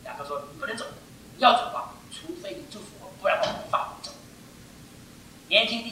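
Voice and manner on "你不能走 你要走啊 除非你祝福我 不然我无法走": intense quiet insistence. Acted. Jacob gripping the angel at night, hushed and stubborn. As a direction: murmur it, refusing to let go.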